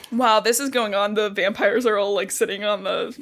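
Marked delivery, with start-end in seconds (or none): none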